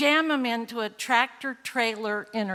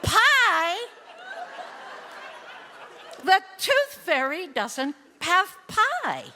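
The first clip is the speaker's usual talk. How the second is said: amazed disbelief; 8 semitones higher, slower than usual. Real, not acted. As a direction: toss back the word in delighted disbelief. Mom's amazed reaction to pie.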